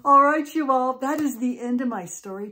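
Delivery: loud, high announcer burst; calling out. Real, not acted. Storyteller tells everyone the story is over, calling out. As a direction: burst out brightly to the audience, easing off. Storyteller breaks out to address audience.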